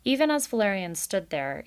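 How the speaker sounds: usual speaking voice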